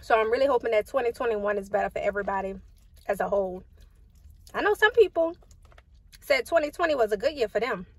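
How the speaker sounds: speaking with food in mouth; muffled words between bites; talking while chewing. Real, not acted. Seen 2: Eats throughout; bites at -2 and 10.2, chewing between words.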